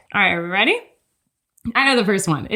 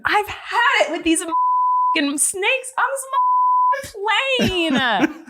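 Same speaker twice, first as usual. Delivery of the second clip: much higher, half laughing, ending in a shout; bigger, louder read; 10 semitones higher, slower than usual. Shown as a demonstration. Co-host asks for another take, so she yells it laughing. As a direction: go big this time and yell out plane. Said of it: "But do it again".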